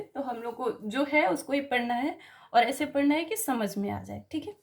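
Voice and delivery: ordinary delivery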